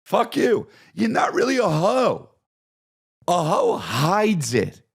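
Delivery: high-pitched comic shout; hammed-up dismissive yell; mock yell. Mock argument with bragging hoe.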